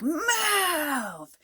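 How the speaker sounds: high, mock shouted command; imitated yell. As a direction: bark it like the angry neighbor. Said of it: "she's, like, screaming".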